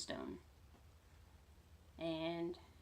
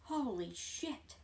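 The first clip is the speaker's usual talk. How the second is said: awed; 5 semitones higher, 3 dB quieter, slower than usual. Real, not acted. Reacting to the moon throw.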